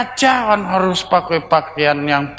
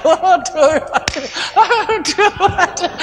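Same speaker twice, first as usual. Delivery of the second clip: high, laughing exclamations of dismay; giggling oh-dear repeated; laughing waduh; 10 semitones higher than usual. Retold or imitated. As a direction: laugh through the groans of despair. Laughing at his own plight.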